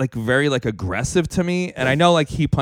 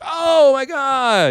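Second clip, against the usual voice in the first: mock amazement; 8 semitones higher, much slower than usual. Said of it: "I'm like".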